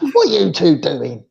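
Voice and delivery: a lightly voiced cat, questioning; cat's imagined voice. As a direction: voice the cat's puzzled look, gently. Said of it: "as if to say".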